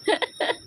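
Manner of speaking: tawa geli pendek bernada tinggi; tawa tinggi singkat. Real, not acted. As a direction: ketawa kecil spontan. Tawa setelah membaca komentar. (tawa geli melengking pendek)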